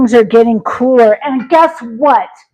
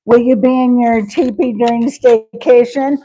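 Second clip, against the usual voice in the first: ordinary talk, nothing marked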